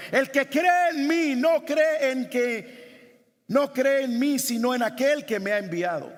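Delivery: loud, high forceful proclamation; full-force exclamation of the verse; exclaimed with energy. Shown as a demonstration. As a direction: cry it out like a shout. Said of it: "Es con la misma energía que él está exclamando".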